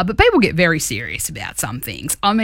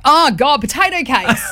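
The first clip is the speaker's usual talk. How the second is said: raised, groaning "Oh, God, potato cakes"; mock disgust; 7 semitones higher than usual. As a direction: play it like a revolted tourist. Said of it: "I was like".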